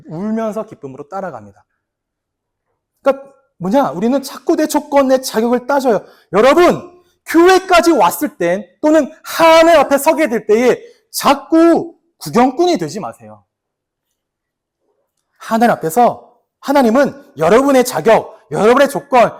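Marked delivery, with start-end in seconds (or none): none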